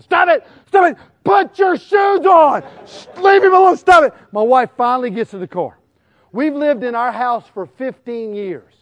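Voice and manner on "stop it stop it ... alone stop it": high-pitched, frantic parental yell; exasperated dad hollering, re-enacted; acted fed-up shouting. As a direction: snap and scream at squabbling kids. Re-enacts yelling at kids in car.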